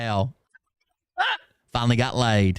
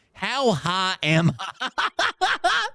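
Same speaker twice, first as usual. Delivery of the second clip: laughing disbelief; 5 semitones higher, much slower than usual. Real, not acted.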